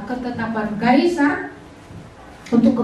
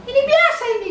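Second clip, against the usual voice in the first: breezy retold reassurance; 12 semitones higher, much faster than usual. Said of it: "aku bilang, biasa".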